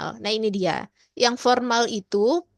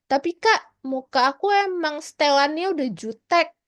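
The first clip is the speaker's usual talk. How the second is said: lightly voiced higher-pitched objection; objecting listener; 4 semitones higher, 3 dB louder than usual. Listener telling an older mentor their face just looks surly, lightly acted protest. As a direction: protest a little higher, as if excusing yourself. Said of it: "Walaupun ada orang yang bilang".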